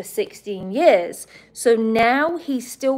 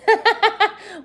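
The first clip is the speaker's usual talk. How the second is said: high bright laugh; a sudden high laugh at a joke; burst of laughter; 12 semitones higher, 5 dB louder than usual. Real, not acted. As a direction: laugh out loud, pitched high. Laughing at chat reply.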